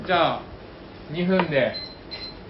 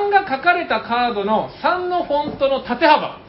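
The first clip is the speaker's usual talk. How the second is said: commentary; 6 semitones higher than usual. Acted. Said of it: "実況っぽくするので".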